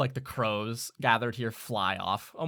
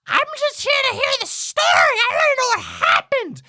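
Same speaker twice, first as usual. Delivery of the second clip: very high, loud screech; shouted squawky bird voice; griping raven; 12 dB louder than usual. Acted. Raven to its fellow raven, loud screech. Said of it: "turns to its fellow raven and goes".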